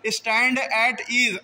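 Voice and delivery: "stand at ease" slowly in three separate words; careful word-by-word "stand at ease"; full correct form. Shown as a demonstration. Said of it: "तो स्टैनिटीज होता है".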